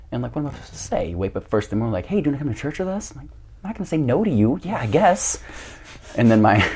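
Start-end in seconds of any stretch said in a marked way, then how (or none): 2.0-3.1 quick, higher mock-chipper voice; imitated invitation. Stepsister waking him to invite him, lightly mimicked. 3.6-5.4 higher-pitched helpless giving-in; mock reluctant agreement. Guest cornered into church, mock reluctant yes. His reluctant reply acted out.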